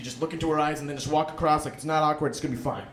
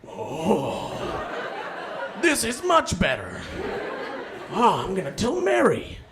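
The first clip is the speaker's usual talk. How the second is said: delighted caveman, slow and pleased; a lightly voiced cave dweller thrilled to walk; caveman Fred; 3 semitones higher, slower than usual. Fred the first walker telling Mary, mildly delighted. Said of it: "And then Fred was like".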